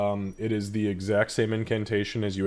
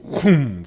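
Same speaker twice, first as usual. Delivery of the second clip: whoosh sound effect; 5 semitones higher, 10 dB louder than usual. Shown as a demonstration. GM narrates the body flaring.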